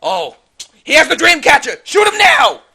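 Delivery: very high, full-force yell; frantic high goblin shouting; goblin alarm shout. Acted. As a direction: scream the order to the squad. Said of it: "the goblins look over at the boy and he's like".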